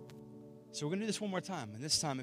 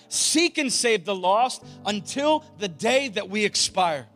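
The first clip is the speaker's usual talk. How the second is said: loud, high preaching prayer; rally speech; 6 semitones higher, 12 dB louder than usual. Real, not acted. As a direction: pray it like a battle cry. Closing prayer at full force.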